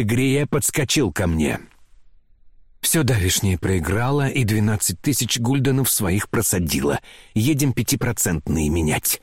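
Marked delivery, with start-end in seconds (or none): none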